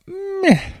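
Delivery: small, high-pitched dismissive meh; mock underwhelmed meh. Narrator imitating dog's reaction to her homecoming, high unimpressed meh.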